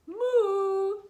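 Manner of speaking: loud, high, long moo; cow mooing. Acted. Cows greet little country mouse, loud moo. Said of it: "said the cows".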